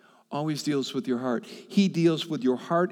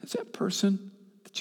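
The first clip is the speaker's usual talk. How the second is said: hushed question; whisper; 7 semitones higher than usual. Real, not acted. Preacher asking the congregation about their partner, whispering. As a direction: lean in and whisper it.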